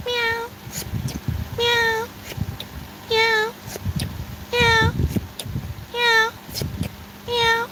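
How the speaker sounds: high, soft meows at the same pitch; NPC cat meow. Acted. NPC meows for a TikTok live.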